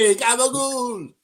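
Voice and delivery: mock Italian-American. Echoing the Italian gabagool bit.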